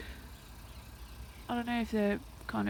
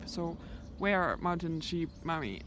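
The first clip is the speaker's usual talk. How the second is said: normal talking voice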